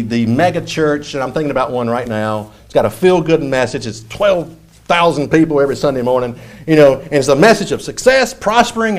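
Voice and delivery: usual speaking voice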